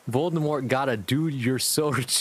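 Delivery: ordinary delivery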